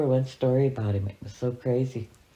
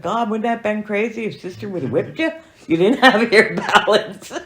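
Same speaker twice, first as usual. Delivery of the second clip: high, louder teasing through laughter; laughing tease; 9 semitones higher, 8 dB louder than usual. Real, not acted. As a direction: tease him while laughing.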